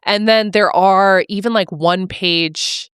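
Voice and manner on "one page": ordinary delivery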